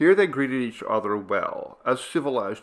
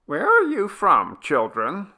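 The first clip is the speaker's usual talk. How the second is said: put-on old granny voice; 10 semitones higher, 6 dB louder than usual. A narrator's reading. Grandmother asks.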